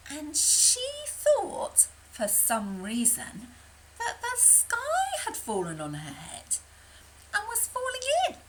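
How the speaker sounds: high, rising alarm about the sky; squeaky worried storytelling. A narrator's reading. Narrator to children, mock alarm as the sky falls. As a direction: build up the silly panic. Chicken Licken's silly idea.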